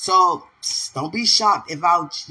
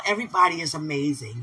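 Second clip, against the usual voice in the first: ordinary delivery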